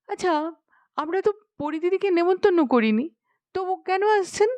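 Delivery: put-on high, quick, petulant girl; high petulant princess; elder princess. A narrator's reading. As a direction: whine it like a spoilt girl.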